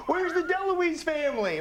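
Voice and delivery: high voice calling out for the family; high stranger voice. Retold or imitated. Bystander calls for the family in the crowd, high voice. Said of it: "he says".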